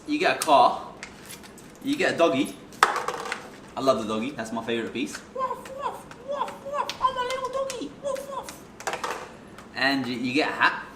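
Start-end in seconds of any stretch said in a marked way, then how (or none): 5.3-9.2 very high, soft little puppy voice. Pretend. Showing friend the dog token, tiny high puppy voice. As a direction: squeak it like a tiny toy dog.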